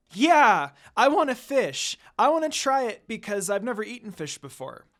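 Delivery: put-on a kid who can't wait for fish; excited child. A narrator's reading. Rin begging Kagome to try fish, loud and excited. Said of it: "with a happy look on her face".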